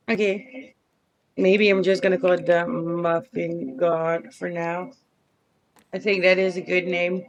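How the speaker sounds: ordinary talk, nothing marked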